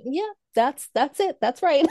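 high, laughing imitation of agreeing; going along with it. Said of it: "I just kinda went".

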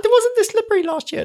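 loud, very high mock kid voice; squeaky child impression; whining child. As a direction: imitate a complaining kid in falsetto. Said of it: "just didn't like the ice".

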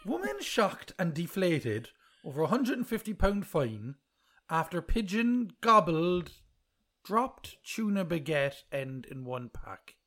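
slow, deliberate rereading; slow careful reading. Shown as a demonstration. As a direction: read it slowly word by word. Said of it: "let me read that sentence again".

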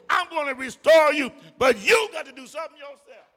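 loud, shouted sermon climax; rising preacher's shout; preaching at full force. Real, not acted. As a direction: whoop it to the back pews.

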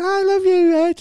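high, loud tipsy woman swooning; drunk heckler. Retold or imitated. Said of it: "He said".

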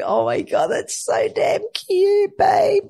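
very high, slow squeal of delight; squealing gush. Retold or imitated. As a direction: squeal like it's unbearably cute. Gushing over husband's cuteness.

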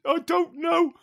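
high, squeaky mock-helpless "I don't know"; helpless reply. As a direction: play the lost little boy.

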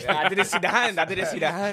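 laughing. Real, not acted. Laughing at the joke.

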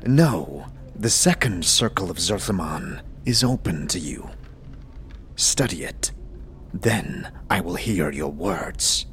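a low, grave mentor voice; low solemn monk. Acted. GM as Dakon counselling the player, low and grave.

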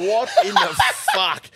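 stunned what. Retold or imitated. Seeing the rider's tall boots, laughing disbelief. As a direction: react to the giant boots in disbelief. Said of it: "I'm going".

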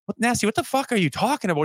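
high-pitched, exasperated bafflement; retold incredulity. Player replays questioning his trainer, incredulous. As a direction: replay a stunned comeback.

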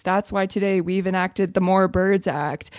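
slow, stiff press-release politician voice; formulaic politician. Retold or imitated. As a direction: read it like a canned government announcement, slowly. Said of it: "speak in this very formulaic manner".

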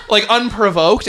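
usual speaking voice

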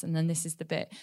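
normal talking voice

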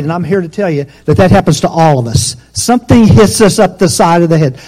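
fiery preaching. Acted. Preacher rousing the church about fear. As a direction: drive the point home to the congregation. Preaching builds to full force.

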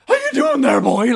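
very high, loud sing-song clown taunt; creepy clown. Retold or imitated. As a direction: leer like a clown leaning over you.

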